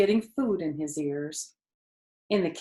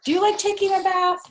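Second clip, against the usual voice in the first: high, quick, bright question; 13 semitones higher, 7 dB louder, faster than usual. Real, not acted. Reader asking listening children, high and playful. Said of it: "asks the listening children".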